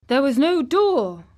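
high, loud protest; boy's disbelieving cry. A narrator's reading. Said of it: "cried Colin".

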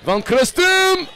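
high, stretched-out shout of the name; excited shot call. Real, not acted. Commentator as the last-second prayer goes up, excited drawn-out cry. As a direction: hang on the name as the shot flies.

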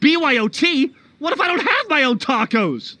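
high-pitched, worried disbelief; alarmed dismay. Acted. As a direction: protest as if the food is at stake. Dismayed at bring-your-own taco bar.

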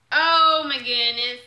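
loud, slow, stretched exclamation; big astonished gush; awed excitement. Real, not acted. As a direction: draw it out in amazement. Opening the next present.

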